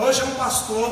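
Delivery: ordinary talk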